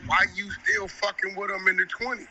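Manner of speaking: ordinary talk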